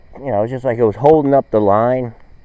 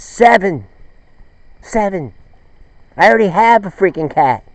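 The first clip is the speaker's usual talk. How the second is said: higher, louder punchline shout; Johnny yelling the punchline, higher and louder; indignant kid yell; 9 semitones higher, 5 dB louder than usual. Retold or imitated. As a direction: blurt it out like an annoyed smart-aleck kid.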